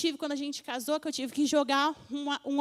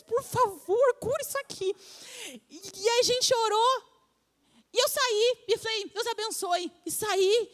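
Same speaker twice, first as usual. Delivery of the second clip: carried away; 6 semitones higher than usual. Real, not acted. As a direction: let the excitement run away with you. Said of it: "me empolguei".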